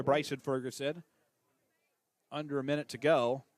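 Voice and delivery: steady radio play-by-play call, tracking each snap; live play-by-play. Acted. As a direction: call the game for listeners at home.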